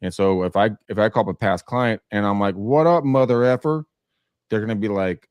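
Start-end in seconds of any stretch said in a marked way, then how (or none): 2.5-3.9 loud, cocky street hello; brash crude greeting. Retold or imitated. Greeting a past client in his seventies with a loud brash hello. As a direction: bro it up at an old client.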